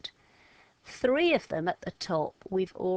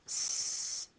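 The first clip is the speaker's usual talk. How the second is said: softer, unvoiced "S." drawn out like a snake; snake hiss; 6 dB quieter than usual. Shown as a demonstration. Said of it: "snake action".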